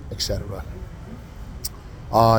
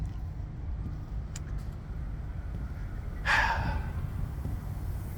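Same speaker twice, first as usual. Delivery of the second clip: slow unvoiced sigh of relief; long sigh; 14 dB quieter than usual. Real, not acted. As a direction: breathe it all out. End of tense story.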